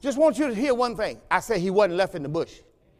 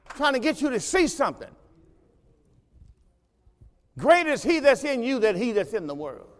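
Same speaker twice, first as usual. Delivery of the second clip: ordinary delivery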